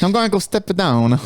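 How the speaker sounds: bad hammy Italian accent. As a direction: play an arrogant Italian, badly. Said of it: "That was the worst Italian accent".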